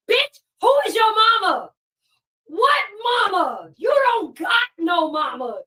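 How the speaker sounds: loud, high, furious yelling; furious shout. Real, not acted. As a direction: scream it at her, outraged. Furious rant at Fishbowl.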